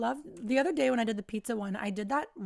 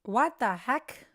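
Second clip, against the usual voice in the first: shocked; 7 dB louder, much slower than usual. Real, not acted. Shocked at demon image.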